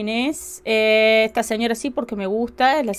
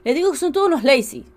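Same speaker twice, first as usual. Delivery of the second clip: ordinary delivery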